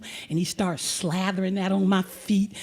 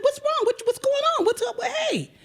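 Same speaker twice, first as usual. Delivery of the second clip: much higher, flustered questions; alarmed protest; 13 semitones higher than usual. Retold or imitated. Teller protesting the snatched bottle, high fluster. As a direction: fluster as your water is grabbed.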